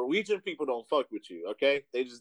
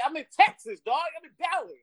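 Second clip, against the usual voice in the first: incredulous high protest. Retold or imitated. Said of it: "I was like".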